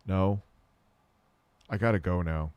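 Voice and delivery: low, understated refusal; deadpan brush-off. Retold or imitated. Man retelling how he excused himself from a hookup, deadpan. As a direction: turn them down without any fuss.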